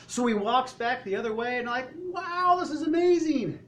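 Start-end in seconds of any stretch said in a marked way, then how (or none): 2.0-3.6 high-pitched marvelling cry; amazed crowd. Acted. Crowd watching him walk back, thrilled amazement. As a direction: gush like a thrilled onlooker.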